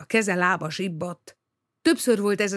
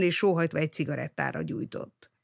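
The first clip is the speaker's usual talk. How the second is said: usual speaking voice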